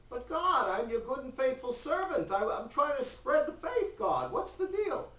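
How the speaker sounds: high-pitched, pleading self-defence; whiny protest. Acted. Abraham defending himself to God, high whiny protest.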